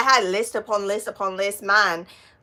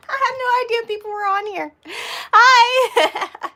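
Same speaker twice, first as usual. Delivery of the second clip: high, startled, breaking into a loud hi; 13 semitones higher, 5 dB louder than usual. Real, not acted. Just discovered people were watching, high startled greeting. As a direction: squeal it high in embarrassed surprise.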